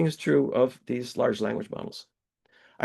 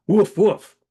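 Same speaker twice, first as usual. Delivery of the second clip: barked "Woof, woof" in a put-on dog voice, louder and higher; mock dog bark; 5 semitones higher, 7 dB louder than usual. Border collie greeting the driver with a mock bark.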